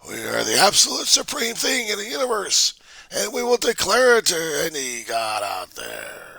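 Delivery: loud, swelling, then whispered grandiose voice; megalomaniac ego. Acted. Vlogger voicing America's ego declaring supremacy. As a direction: rave like a mad god-king.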